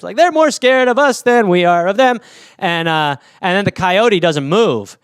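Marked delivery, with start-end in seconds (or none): 0.2-2.2 high, booming mock confidence; hammed-up confident declaration; loud confidence. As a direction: proclaim it boldly, pitched up. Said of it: "I take a confident five steps".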